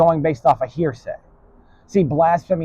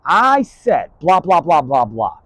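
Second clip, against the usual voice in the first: forceful retort; 5 semitones higher, 6 dB louder, slower than usual. Retold or imitated. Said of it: "and I was like".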